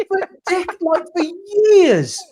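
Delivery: high, loud mock rant, laughing through it; hammed-up indignation, pitched well up; retold comeback. Telling off rival fans, loud and high with laughter. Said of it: "I was like".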